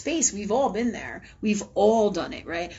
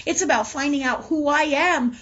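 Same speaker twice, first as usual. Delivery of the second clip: ordinary delivery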